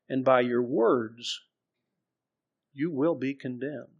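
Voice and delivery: slow, weighty reading of Jesus' words; scripture reading. Acted. Preacher reading Matthew to the congregation, slow and solemn. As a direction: draw out each phrase solemnly.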